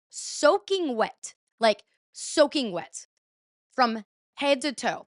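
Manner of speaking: "soaking wet" stretched out slowly; drawn-out emphasis. Real, not acted. Girlfriend describes her drenched boyfriend, emphatic. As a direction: linger on "soaking" and "head to toe", very slow. Dwells on how drenched he was.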